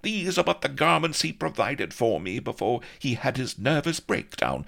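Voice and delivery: high, loud archaic scolding; scolding lady. Acted. Vanity scolding Blood about her garments, high and loud. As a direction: rebuke the muck rake.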